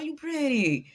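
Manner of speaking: high, swooping admiration; gushing compliment. Acted. Telling a pretty girl straight up, gushing.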